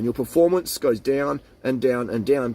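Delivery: ordinary talk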